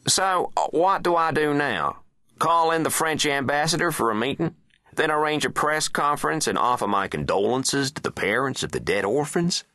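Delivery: high-pitched, sarcastic presidential questioning; exasperated president. Acted. President asking his men what to do, high and exasperated. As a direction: fire questions in a high, put-upon voice.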